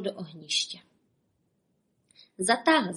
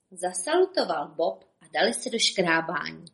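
ordinary delivery, nothing marked

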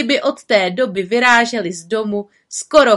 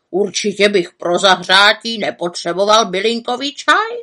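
scolding raven; 2 semitones higher, 5 dB louder than usual. A narrator's reading. Raven scolds the witch for neglecting herself, exasperated. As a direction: lecture her like a sensible raven.

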